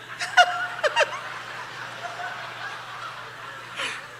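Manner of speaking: high breathy sneaky laugh; sly giggle. Retold or imitated. Wife laughing behind her husband's back. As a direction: giggle in secret triumph.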